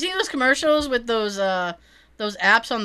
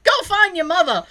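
raised, louder mock-angry dad voice; steaming dad; 7 semitones higher, 5 dB louder than usual. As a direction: send the kid off like an irate dad. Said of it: "he's steaming 'cause he hates disrespect".

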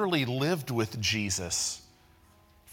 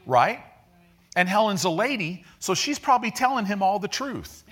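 higher, louder preaching, building excitement; fired-up preaching; 7 semitones higher, 4 dB louder than usual. Acted. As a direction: preach it with rising energy, pitch climbing. Builds an excited sermon illustration.

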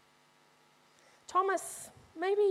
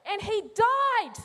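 bitter doubting Thomas; 6 semitones higher, 3 dB louder than usual. Acted. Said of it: "it's like he's saying".

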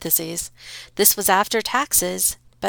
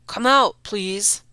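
calling out; 3 semitones higher, 6 dB louder, slower than usual. Acted. Father calls his daughter out. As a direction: call her out. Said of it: "the father called".

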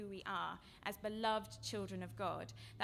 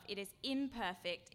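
ordinary delivery

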